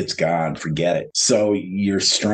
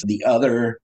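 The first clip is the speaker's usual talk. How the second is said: ordinary talk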